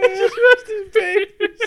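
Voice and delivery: high, laughing, close to tears; tearful laughter. Real, not acted. Joking about the baby photos, high tearful laughter.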